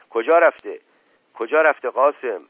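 loud, high anxious questioning; urgent demand. Acted. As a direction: press the servant for news at once. Uncle anxiously questions returning servant.

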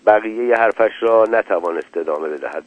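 plain reading voice

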